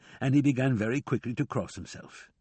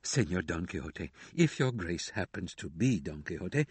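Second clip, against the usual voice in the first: softer, lower, grieving plea; a mild mournful hush, speaking from sorrow; 5 semitones lower, 4 dB quieter than usual. A narrator's reading. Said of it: "in a low and grieving voice she responded".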